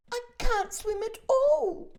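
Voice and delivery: very high, loud frightened cry; ladybird crying out. A narrator's reading. The ladybird admits she cannot swim, alarmed. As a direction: wail it in alarm. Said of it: "cried the ladybird".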